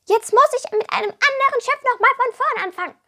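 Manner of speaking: high, loud moaning kobold with a laugh; grumbling kobold. A narrator's reading. Kobold grumbling to himself as he stomps past, high and loud. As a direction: grouse like a sulking goblin. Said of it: "meckerte er vor sich hin".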